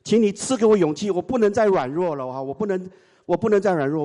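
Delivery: high, earnest plea for courage; pleading prayer. Retold or imitated. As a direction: beg God for strength. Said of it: "我说".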